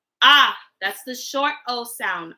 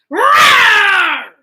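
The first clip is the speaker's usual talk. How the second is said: louder, higher big tiger roar; daddy tiger roar; 6 semitones higher, 8 dB louder than usual. Shown as a demonstration. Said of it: "show me your daddy tiger".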